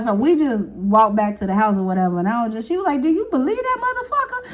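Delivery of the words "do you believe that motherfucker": high, laughing outrage; laughing disbelief. Retold or imitated. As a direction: laugh through the question. Friend laughing about him.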